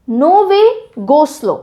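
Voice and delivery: ordinary talk, nothing marked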